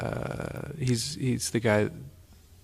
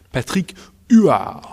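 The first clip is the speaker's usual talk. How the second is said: Patrick Huard slow and loud in a hammed-up French accent; 5 semitones higher, 10 dB louder, slower than usual. Shown as a demonstration. Podcast host mocking the accent, hammed-up French accent. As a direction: show off an obnoxious French accent on the name. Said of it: "say everything in French accents thing".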